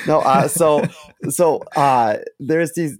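ordinary talk, nothing marked

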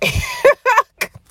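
sudden high, loud laugh; high emotional laugh. Real, not acted. Podcaster after apologising to listeners, high embarrassed laugh.

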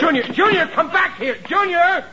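high, loud call after the runaway son. Acted. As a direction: yell Junior back from down the street. Father calls fleeing son back.

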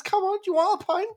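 high, loud Cockney lad offering a pint; mock cheeky London kid. Imitating a cheeky London kid's Cockney. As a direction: ham up a London wide boy. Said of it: "who talks like this".

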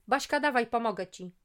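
loud, high call to action; bossy friend bursting in to help, loud and high; booming shout. Acted. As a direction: burst in and boom it. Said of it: "huknęła".

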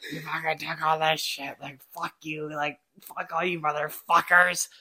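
half-swallowed mock rant; quiet grumbling impression of a teammate; imitating Vanny. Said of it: "He goes".

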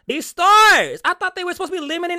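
loud, high burst of exasperation; exasperated outburst. Real, not acted. Host venting to viewers about crowded stores, exasperated outburst.

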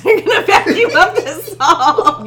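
high, loud laughing exclamation; excited retelling. Retold or imitated. Retelling a reaction to the vacuums arriving, excited and laughing. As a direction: crow it with laughter breaking in.

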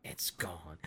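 a hushed breathy whisper; quick whisper to the co-host. Real, not acted. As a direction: breathe it out under your breath. Joking aside after a reveal.

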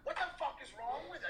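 high-pitched mock outrage; furious outburst. A man shrieking at a rough hookup in mock fury. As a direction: yelp it like you're suddenly furious. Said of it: "Like, I got furious".

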